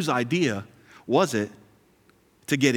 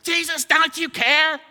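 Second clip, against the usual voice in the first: much higher and louder, mock-desperate whine; whiny complainer; 7 dB louder than usual. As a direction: whine about the broken fridge like it's the end of the world. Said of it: "we're like".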